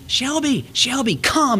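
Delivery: put-on high child's call to the dog; little girl calling. Daughter calling the family dog, high childlike call. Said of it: "Maggie will scream".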